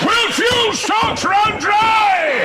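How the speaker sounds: high, slow, loud doom announcement; slow headline shout. Pretend. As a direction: shout each word like a front page. Reading tabloid headlines melodramatically.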